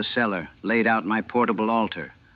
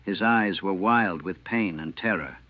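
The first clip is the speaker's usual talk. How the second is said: usual speaking voice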